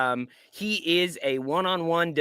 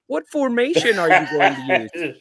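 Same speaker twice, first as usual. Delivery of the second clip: laughing mock question; 3 semitones higher, 3 dB louder than usual.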